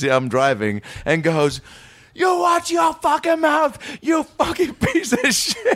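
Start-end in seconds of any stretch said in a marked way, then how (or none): 2.1-5.8 very high, slow Boston screech; screamed mock Boston insult, words stretched; Boston accent. Drunk passenger leans over to toll guy, screeching Boston accent. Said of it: "speaking in my best Boston accent".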